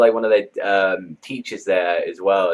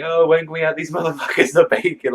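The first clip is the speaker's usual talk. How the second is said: higher-pitched retold outburst; mild mock scream with a laugh breaking in; mock yell; 8 semitones higher, 3 dB louder than usual. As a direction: imitate a teacher screaming it out, half-hearted. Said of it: "He just screams it out".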